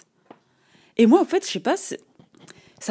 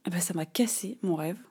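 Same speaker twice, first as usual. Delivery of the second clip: whispered; 7 dB quieter than usual. Real, not acted. Confessing the hurt.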